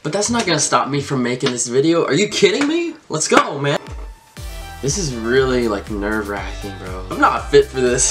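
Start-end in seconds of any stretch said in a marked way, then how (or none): 2.1-3.8 pitched up high in excitement; fired up, can't believe it; excited disbelief. Real, not acted. Psyching himself up on camera.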